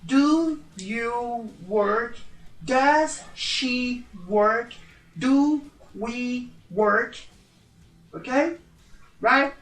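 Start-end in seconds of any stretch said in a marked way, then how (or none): 0.0-7.3 very slow, every word clear; slow drill. Shown as a demonstration. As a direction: spell out each question slowly for students. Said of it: "Mais uma vez". (pergunta lenta e bem articulada)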